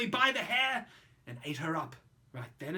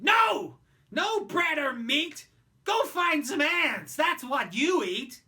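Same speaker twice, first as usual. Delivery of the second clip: loud, high bratty yell, slow and hammered; Roy shouting; 8 semitones higher, 10 dB louder, slower than usual. Acted. Spoiled Roy refusing the starving anteater food, angry shout. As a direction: shout the creature down. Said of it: "Roy shouted".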